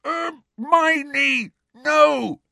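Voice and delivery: high shout through a gag; muffled gagged shout. A narrator's reading. Ron begs Hermione to stop, shouting through gag. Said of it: "shouted Ron through his gag".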